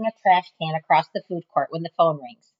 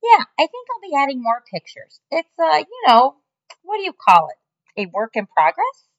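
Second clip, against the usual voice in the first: higher, louder boss, showing off; boastful boss; 10 semitones higher, 7 dB louder than usual. Acted. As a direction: brag about it on the phone. Mac on phone.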